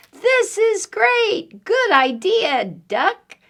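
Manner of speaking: high, drawn-out cheer; delighted animals. Acted. Animals thank duck for the idea, high glee. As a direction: exclaim high, then ease down.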